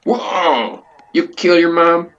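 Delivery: high-pitched, startled outburst; shocked. Acted. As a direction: react in loud disbelief. Shocked reaction to a confession.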